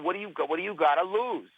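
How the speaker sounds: imitated Yonkers accent, challenging. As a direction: push them in a Yonkers, New York accent. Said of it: "she's got this accent".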